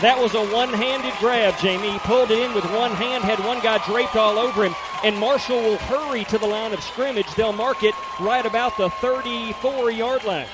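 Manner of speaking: excited. Real, not acted. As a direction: rave about the grab. Co-announcer raves about catch.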